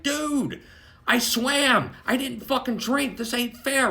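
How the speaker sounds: high-pitched, pleading exasperation; acted-out aggrieved whine of protest; exasperated protest. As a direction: plead your case, pitched well up.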